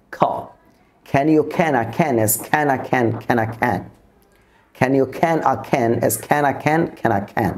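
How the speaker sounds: slow tongue twister, every can begun as k-h; measured delivery, airy k release on every can; aspirated k drill. Shown as a demonstration. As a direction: drill each can slowly with a puff of air. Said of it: "এভাবে আমরা টান twister দিয়েও".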